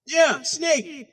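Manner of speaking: usual speaking voice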